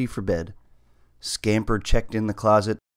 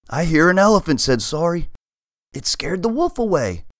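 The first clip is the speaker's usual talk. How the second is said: scared; 8 semitones higher, 4 dB louder than usual. Acted. Frightened cat hears elephant.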